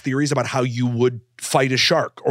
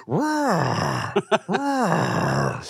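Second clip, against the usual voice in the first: lightly voiced lion going roar; 6 semitones higher than usual. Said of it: "a lion walking around Miami being like".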